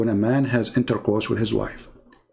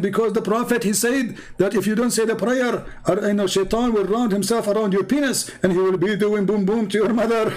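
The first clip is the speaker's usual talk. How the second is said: 高八度模仿打电话的穆斯林; 尖声模仿对方的辩解; 8 semitones higher than usual. Retold or imitated.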